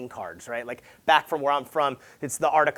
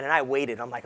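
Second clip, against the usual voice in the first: usual speaking voice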